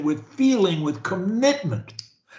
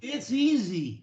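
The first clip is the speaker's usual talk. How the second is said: with energy; 4 semitones higher, 5 dB quieter than usual. Shown as a demonstration. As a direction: sell it with energy and conviction. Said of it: "the way I do, with energy".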